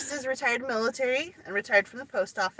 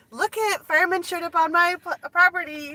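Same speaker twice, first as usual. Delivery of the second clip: excited; 9 semitones higher than usual. Retold or imitated. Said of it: "she's like".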